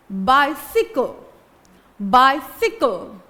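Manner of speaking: बाइसिकल slow, eye sound clear at the start; slow model word. Shown as a demonstration. To students, a teacher slowly models बाइसिकल. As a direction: say बाइसिकल slowly with a long bai. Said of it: "ई का sound जब आता है तो बाइसिकल".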